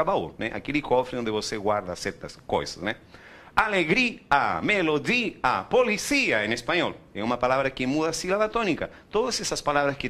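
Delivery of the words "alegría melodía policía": "Alegria, melodia, policía" with a stretched, stressed I before the A; stressed I in hiatus. Shown as a demonstration. Teacher modelling Spanish examples, "Alegria, melodia, policía" with stressed, separated I. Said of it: "tienen acento en la letra I".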